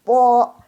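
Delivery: po with no puff of air; loud, held po, airless p; unaspirated p. Shown as a demonstration. Teacher recalling the airless sound, po unaspirated. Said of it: "Tadi kan nggak keluar udara".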